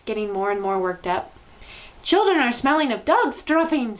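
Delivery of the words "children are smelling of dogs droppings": high, loud witch screech. A narrator's reading. Grand High Witch screeching to her audience. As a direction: screech the insult. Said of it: "screeched the Grand High Witch".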